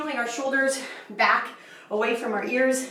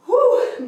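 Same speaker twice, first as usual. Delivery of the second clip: relieved whew; 9 semitones higher, 8 dB louder than usual. Real, not acted. Breathless relief after the set.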